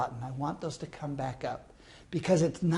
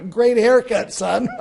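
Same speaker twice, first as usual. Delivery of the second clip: louder, laughing mockery; laughing tease; 5 semitones higher, 8 dB louder than usual. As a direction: tease him through laughter. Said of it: "he's laughing. He says".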